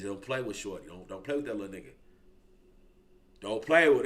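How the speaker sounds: low, quiet mock of Big Vinny warning people off; Big Vinny's warning. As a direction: warn them softly and low, lightly doing Big Vinny. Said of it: "He says".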